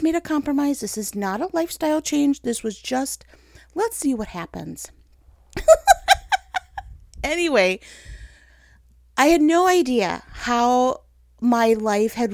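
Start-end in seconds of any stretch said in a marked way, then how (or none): 5.6-6.9 loud high laugh; real loud laugh; high burst of laughter. Real, not acted. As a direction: let a big laugh burst out. Laughs at her compromise.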